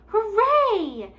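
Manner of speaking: high, loud cheer; yelling. A narrator's reading. Younger brother cheering about the fair, loud yell. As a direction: yell with joy. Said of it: "yelled one of".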